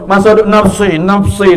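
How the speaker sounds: normal talking voice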